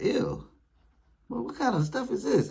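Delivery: high, squeamish disgust; mock disgusted whine; grossed out. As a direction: recoil from a sink full of dishes. Sees dirty dishes in sink.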